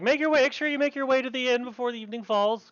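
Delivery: high, quick villager voice; townswoman's send-off. Acted. Townswoman sends visitors to the inn, high quick parting words. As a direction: hurry them along in a high, brisk voice.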